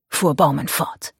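ordinary delivery

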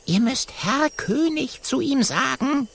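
high little monkey voice, hushed; lightly whispered monkey boy; whispered aside. A narrator's reading. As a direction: whisper the tip to the friends. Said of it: "Jojo flüstert Kokosnuss und Oskar zu".

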